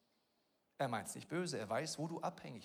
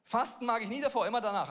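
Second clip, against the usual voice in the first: louder and higher, hammering it home; emphatic repeat; 6 semitones higher, 8 dB louder, faster than usual. Shown as a demonstration. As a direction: repeat it with preacher's force. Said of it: "Das ist tief".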